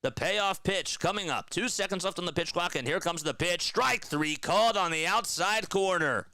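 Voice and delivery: lively baseball play-by-play call. Acted. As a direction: call the action like a broadcaster. Streamer calls the game.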